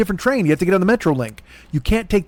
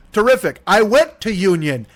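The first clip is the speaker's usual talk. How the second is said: usual speaking voice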